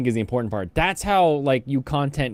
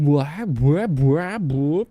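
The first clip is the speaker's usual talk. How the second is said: mock blah blah; 4 semitones higher, much slower than usual. Mocking generic commentary.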